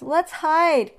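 high, loud urgent cry. A narrator's reading. Monkeys urging everyone to hide, crying out. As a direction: call it out in alarm. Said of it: "cried the monkeys".

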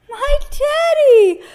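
very high, loud, drawn-out cry; high-pitched childlike call, stretched out. A narrator's reading. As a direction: cry out slowly as little Eddie. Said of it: "cried Eddie".

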